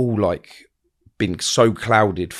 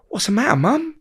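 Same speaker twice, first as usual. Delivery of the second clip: much higher, sweet innocent voice; mock innocent. Son feigning innocence to angry mum, high sweet voice. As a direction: play the innocent child.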